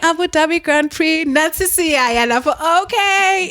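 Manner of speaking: high-pitched, impressed reaction to a lyric; excited fan. Retold or imitated. Guest reliving first hearing [name]'s song, excited. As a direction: savour each phrase, pitched well up.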